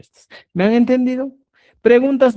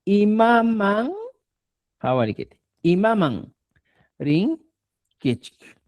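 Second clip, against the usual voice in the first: slow model word; 4 semitones higher than usual. Shown as a demonstration.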